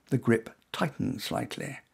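ordinary delivery

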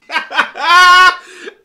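big loud laugh at his own joke; loud burst of laughter. Real, not acted. Laughing loudly at his own prank call bit. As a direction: crack up loudly.

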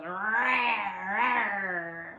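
playful drawn-out leopard growl; leopard snarl. Shown as a demonstration. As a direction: imitate a snarling leopard for children. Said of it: "The leopard goes".

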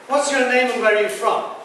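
ordinary talk, nothing marked

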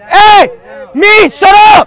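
loud, furious yell; angry shout. Real, not acted. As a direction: bark it at him. Shouting during a scuffle.

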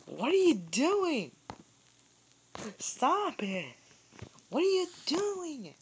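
high, drawn-out exasperated cry; high-pitched scolding. Real, not acted. Scolding someone during the game.

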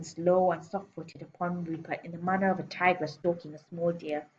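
ordinary delivery

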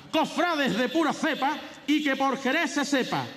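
high, ringing proclamation of verse; pregón herald. Acted. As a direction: declaim it like a festival herald.

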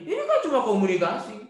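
high mock-innocent justification; making excuses. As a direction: imitate someone protesting it is harmless, ending on a rise.

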